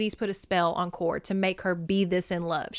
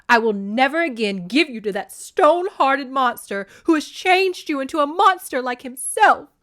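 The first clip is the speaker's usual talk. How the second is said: loud, higher furious shout; 5 semitones higher, 7 dB louder than usual. A narrator's reading. Demeter screaming at her daughter about Hades, furious. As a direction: hurl it at her like a curse. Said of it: "Demeter shouted".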